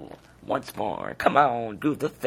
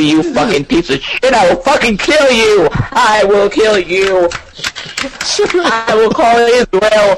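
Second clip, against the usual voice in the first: screaming, much higher and louder threats; furious yelling; 9 semitones higher, 12 dB louder than usual. Real, not acted. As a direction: scream at him with all your rage. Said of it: "doing a little bit of yelling".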